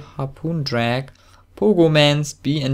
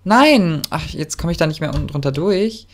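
ordinary talk, nothing marked

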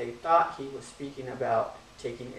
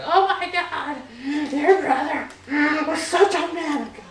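hammed-up high, loud funeral wailing; mock sobbing; 13 semitones higher, 7 dB louder than usual. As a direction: wail like an overdramatic mourner, badly faking tears. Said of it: "everybody breaks out in tears".

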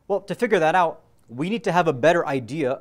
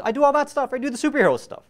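much higher, eager boasting; excited; 9 semitones higher than usual. Real, not acted. Preacher describing his lucid dream powers, excited.

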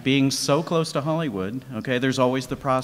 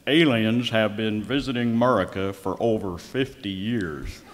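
strong Southern accent; 4 semitones lower than usual. Shown as a demonstration. Host demoing a Southern accent to sound believable. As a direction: say it like a Southern good ol' boy. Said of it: "in a strong, uh, Southern accent".

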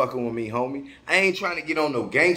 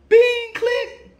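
phone hang-up noise; 5 dB louder than usual. Retold or imitated. Imitating hanging up phone.

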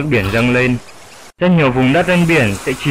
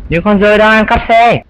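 alarmed shout; 9 semitones higher than usual. Acted. Sudden alarm about car thieves.

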